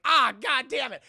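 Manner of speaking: loud, high outcry; exasperated cursing. Retold or imitated. Carter as all the guys stand up, exasperated yell. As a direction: curse as the trouble lands on you. Acted cop as gang stands up.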